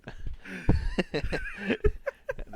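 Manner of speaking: high burst of laughter; loud laugh. Real, not acted. Laughing at own joke.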